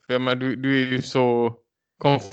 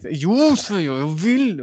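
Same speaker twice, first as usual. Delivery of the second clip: drawn-out, higher insistent plea; mock insistence; 6 semitones higher, slower than usual. Retelling how the woman would not give in, insistent. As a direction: imitate her digging in.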